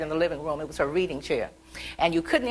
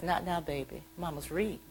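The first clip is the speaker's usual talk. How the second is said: mother's unchanging tone; 10 dB quieter than usual. Retold or imitated. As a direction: imitate an absorbed mother murmuring. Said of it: "say in the same tone of voice".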